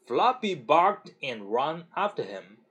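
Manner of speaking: plain reading voice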